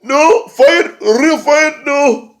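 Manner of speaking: very high, loud pleading squeal; mock Grado plea. Grado begging Drew to fight for real, very high squeal. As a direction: whine like a heartbroken pal. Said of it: "Grado's like".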